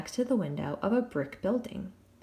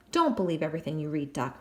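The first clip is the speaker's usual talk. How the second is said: put-on fast, lower brush-off in English accent; quick dismissive English; 4 semitones lower, faster than usual. Jack brushing off Daphne's book, quick English brush-off. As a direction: wave her off quickly.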